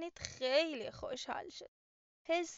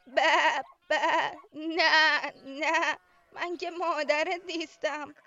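loud bleats, then the answer; bleating sheep; 11 dB louder, much slower than usual. Acted. Sheep bleats back at the chick, loud bleating voice. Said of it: "گوسفند گفت".

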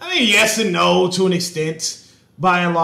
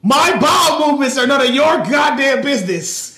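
higher and louder, exasperated; indignant shout; 7 semitones higher, 4 dB louder than usual. Real, not acted. Shouting at his teasing co-host, indignant. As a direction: yell it back in mock outrage.

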